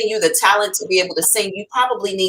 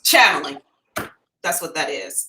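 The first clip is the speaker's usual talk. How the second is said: ordinary delivery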